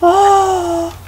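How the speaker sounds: sudden high "Oh!"; startled gasp. Real, not acted. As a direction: yelp in surprise at an enemy. Spots an enemy outside.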